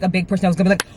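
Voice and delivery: ordinary talk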